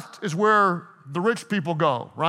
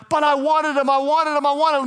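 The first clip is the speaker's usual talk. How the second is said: high, loud mock whine; whiny heartbroken girl; 6 semitones higher, 3 dB louder than usual. Preacher mocking a ghosted girlfriend, whiny. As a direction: plead like a dumped girlfriend, hammed up. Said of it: "You're like".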